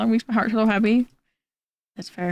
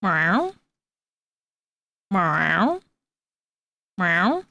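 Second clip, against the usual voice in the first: rising mock meows; playful meow to Victor; meowing at cat; much slower than usual. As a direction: call back to the cat with a meow. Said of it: "I'm communicating with Victor".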